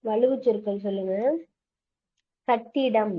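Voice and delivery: ordinary delivery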